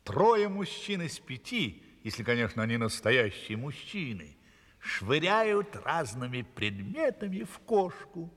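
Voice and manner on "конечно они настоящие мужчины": ordinary delivery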